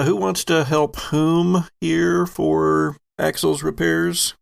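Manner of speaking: ordinary talk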